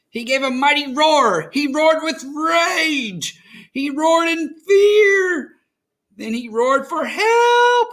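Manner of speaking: rising storyteller drama; dramatic build. A narrator's reading. Dramatic narration of roaring.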